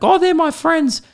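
very high, sugary mock voice; doting dog lady. Mocking the five-dog lady, very high and sugary. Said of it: "She was one of those people".

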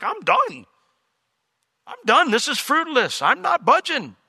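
high-pitched, fed-up refusal; mock exasperation. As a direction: act out digging your heels in, pitch well up. Voices the Lord refusing Jacob.

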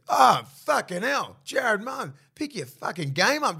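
loud, high yell at the player; angry heckle. Retold or imitated. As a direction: bellow it like a furious fan in the pub. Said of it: "he goes".

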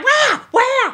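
high, loud, panicked Where? Where?; frantic calling. Retold or imitated. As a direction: shout it desperately, searching. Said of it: "I'm going".

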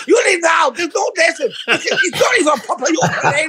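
very high, exasperated mock Chinese shopkeeper; Chinese accent. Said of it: "he said something in Chinese".

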